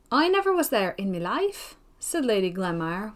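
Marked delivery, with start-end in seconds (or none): 0.1-1.8 put-on Scottish accent. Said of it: "with a broad Scotch accent, but in a very sweet voice".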